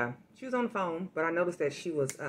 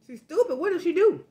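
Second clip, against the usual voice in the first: much higher, louder, echoing for the room; loud repeating; 12 semitones higher, 7 dB louder than usual. Pretend. Repeating the scammer's words aloud for the coworker, high and loud. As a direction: repeat his words so the victim hears. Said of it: "repeating everything he says so she can hear".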